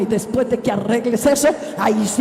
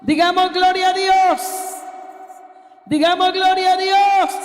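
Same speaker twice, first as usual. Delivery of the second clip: high, loud preacher's shout; ringing, high-pitched exhortation; rallying praise call; 9 semitones higher, 4 dB louder than usual. Real, not acted. Preacher calls congregation to praise.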